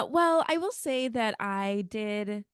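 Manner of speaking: ordinary talk, nothing marked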